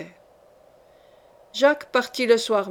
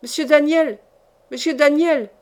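raised, higher-pitched call to someone upstairs; 4 semitones higher, 4 dB louder than usual. A narrator's reading. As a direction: call the name up the stairs. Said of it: "qui l'appelait d'en bas".

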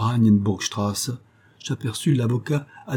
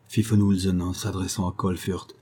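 usual speaking voice

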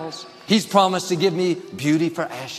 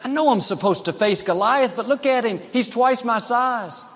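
higher-pitched mock protest; imagined doubting David; 6 semitones higher than usual. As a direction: act out someone listing excuses before a giant. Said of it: "He could have easily gone around saying".

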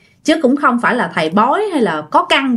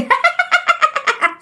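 high eerie cackle; evil ghost laughter. Acted. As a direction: cackle like a triumphant spirit. (tiếng cười ma quái the thé)

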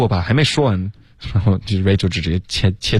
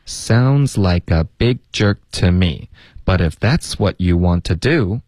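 same sentence at a more natural pace; quicker linked read. Shown as a demonstration. Teacher repeating the whole line for learners, more fluent pace. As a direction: run the linked words together more fluently. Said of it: "然后我们从头再来一次".

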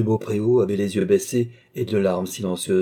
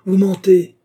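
loud, high cry of accusation; outraged shout; 12 semitones higher, 10 dB louder, slower than usual. A narrator's reading. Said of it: "s'écria monsieur de Beaupréau".

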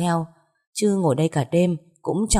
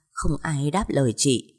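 plain reading voice

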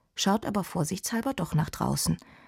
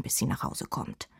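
ordinary delivery

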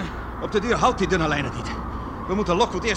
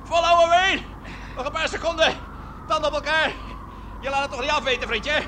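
calling out; 7 semitones higher than usual. Acted. Calling encouragement to dangling man.

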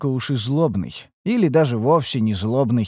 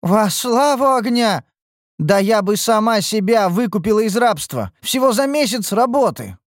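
excited; 10 semitones higher, 3 dB louder than usual. Acted. Ниуру восторгается заработком.

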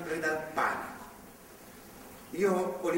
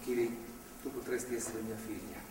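much softer and lower, like a father; soft fatherly murmur; tender, soft; 9 semitones lower, 10 dB quieter than usual. Acted. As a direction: speak to her gently as a daughter. Priest speaks tenderly to girl.

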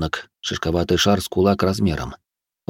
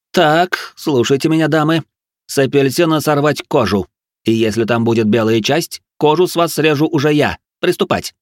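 high, threatening drill orders; menacing manic chef; crazed cooking instructor; 7 semitones higher, 4 dB louder than usual. A narrator's reading. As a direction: give orders in a raised, fevered voice.

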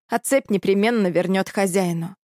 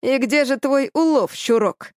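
gritted menace; 4 semitones higher than usual. Acted. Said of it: "процедил Туз".

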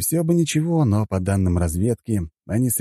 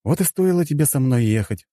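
mildly indignant, pitched up; higher-pitched mild protest; mild indignation; 6 semitones higher than usual. A narrator's reading. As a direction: grumble at your wife, a touch indignant. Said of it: "возмутился я".

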